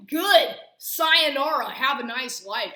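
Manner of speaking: loud, high triumphant send-off; gleeful shout. Real, not acted. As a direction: yell it out like good riddance. Glad mother moving away.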